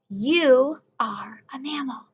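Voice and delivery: whispered like a secret. Acted. Teacher whispering the answer to young students. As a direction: hush it, a gentle secret.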